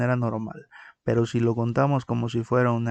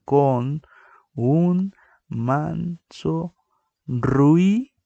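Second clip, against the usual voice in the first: "un manso ruido" very slow and higher, "ruido" louder; slow, higher teaching count; syllable-by-syllable count; 4 semitones higher, 5 dB louder, much slower than usual. Shown as a demonstration. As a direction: stretch "ruido" as two syllables "ru-i". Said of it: "Si nosotros la contáramos normalmente".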